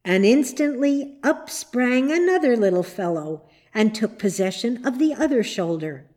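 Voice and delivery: ordinary delivery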